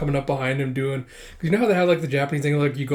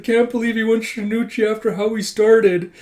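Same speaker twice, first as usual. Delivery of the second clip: high, loud, weepy disbelief; mock tearful blubbering; 8 semitones higher, 5 dB louder than usual. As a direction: blubber on, overcome for a friend. Crying player on video call.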